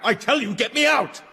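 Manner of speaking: angry demand. Acted. Trapped patient demanding release.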